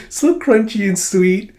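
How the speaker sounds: put-on slow, high, dreamy little girl; blissful munching. Little girl eating cake, slow high dreamy voice. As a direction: savour each word like a happy child. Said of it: "And Anya's just like".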